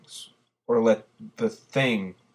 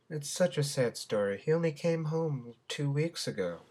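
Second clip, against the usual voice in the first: higher, softer co-anchor voice; sympathetic co-anchor; 6 semitones higher, 7 dB quieter than usual. Acted. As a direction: lament it softly in a raised voice. Co-anchor laments the missing hero.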